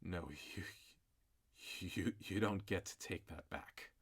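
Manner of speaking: cold hushed fury. Acted. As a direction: barely hold back rage. Wounded mother, cold fury.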